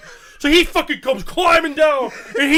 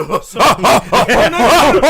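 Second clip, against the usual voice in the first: louder, slow drawn-out no; mock alpha refusal; 10 dB louder, slower than usual. [name] as alpha rejecting Pete, loud drawn-out no. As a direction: refuse the challenger flatly.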